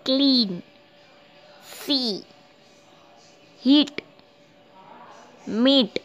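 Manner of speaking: क्लीन, सी, हीट, मीट each with a held "ee". Shown as a demonstration. Teacher showing E with A, क्लीन to मीट said with "ee". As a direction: sound out क्लीन, सी, हीट, मीट separately, stretching the "ee". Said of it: "उसका उच्चारण ई".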